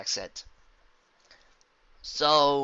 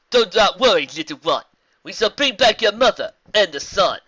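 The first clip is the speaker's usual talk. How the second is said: loud, higher zebra voice; reassuring zebra; 8 semitones higher, 14 dB louder than usual. Acted. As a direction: promise it firmly in rhyme. Zebra comforts filly.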